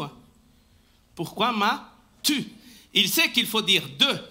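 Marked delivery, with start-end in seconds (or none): 1.1-2.4 slow build to a set-apart "tu"; stress on TU. Shown as a demonstration. Preacher showing Jesus addresses one person, stress on "tu". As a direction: draw out "Pourquoi m'as-", then land on "tu". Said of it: "Il dit pas vous".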